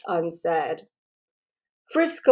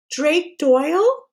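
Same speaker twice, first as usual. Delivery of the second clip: put-on higher, slow young girl voice; girl's voice; 7 semitones higher, slower than usual. A narrator's reading. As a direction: ask it as Caitlin, unsure. Caitlin on the phone.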